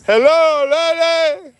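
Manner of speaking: high, loud call across a distance; hailing someone far away at the top of the voice. Real, not acted. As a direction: shout hello to a far-off lady. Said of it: "I had to scream at the lady".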